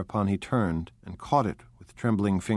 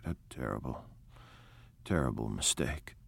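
hushed despair; 8 semitones lower, 10 dB quieter than usual. A narrator's reading. Gatsby repeats, shaking his head.

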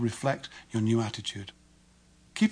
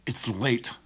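tight, contained anger; controlled irritation; 4 dB quieter than usual. Shown as a demonstration. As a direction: say it angrily, without raising the volume. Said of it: "If I say it with anger".

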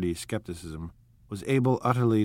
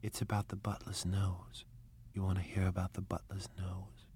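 whispering; 11 dB quieter than usual. A narrator's reading. Whispered gossip at dinner table.

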